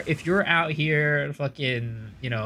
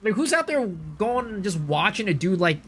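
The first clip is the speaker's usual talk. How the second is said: ordinary talk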